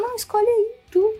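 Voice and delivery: high-pitched imitation of partner; mocking high voice. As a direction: squeak it out like her. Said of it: "ela falou". (imitação aguda da namorada)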